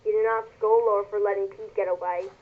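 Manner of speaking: ordinary delivery, nothing marked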